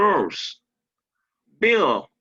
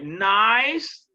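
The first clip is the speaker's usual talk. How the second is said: NICE drawn out with rising pitch, louder; rising stress on NICE; 3 semitones higher, 5 dB louder than usual. Shown as a demonstration. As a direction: lift and lean on NICE. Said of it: "je monte". (« Nice » accentué, la voix qui monte)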